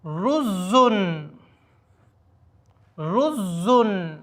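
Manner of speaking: رزن stretched very slowly with the doubled ز held. Shown as a demonstration. Teacher to students showing the doubled letter slowly. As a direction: lean on the ز in رزن. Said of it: "زا پر تشدید ہے".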